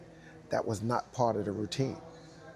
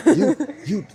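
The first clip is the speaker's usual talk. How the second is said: much higher and louder, laughing through it; laughing excitedly; 10 semitones higher, 13 dB louder than usual. Retold or imitated. Director praising the dancer, laughing excitedly. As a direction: burst out laughing mid-word.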